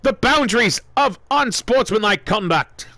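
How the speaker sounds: excited wrestling play-by-play with yells on the big moments; live play-by-play. Acted. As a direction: call every move like a hyped ringside announcer. Wrestling game play-by-play commentary.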